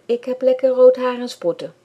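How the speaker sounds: put-on a shy boy finally shouting back; defiant retort. A narrator's reading. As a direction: Bram shouts back at Lisanne, loud and defiant.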